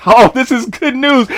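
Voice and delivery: usual speaking voice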